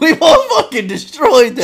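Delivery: high, loud, laughing boast. Real, not acted. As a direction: cackle through the brag. Bragging through laughter.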